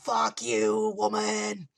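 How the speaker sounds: high, drawn-out imitation scream; mock scream. Vision finally loses it at his wife, mock screaming. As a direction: imitate yelling at the top of your lungs without full volume. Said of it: "He is screaming".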